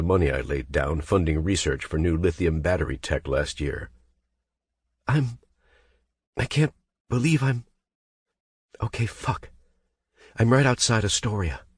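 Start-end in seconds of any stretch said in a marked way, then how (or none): 5.1-11.7 high, halting and shaken; shaken rambling. Acted. Becca giving Grant her location, shaken. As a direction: stumble through it, barely holding on.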